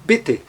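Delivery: B T, short clipped I; short I demo. Shown as a demonstration. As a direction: clip the I in B T. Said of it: "tem um som breve". (I breve em B T)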